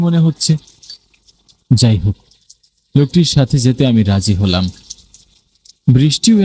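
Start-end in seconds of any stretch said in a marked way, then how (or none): none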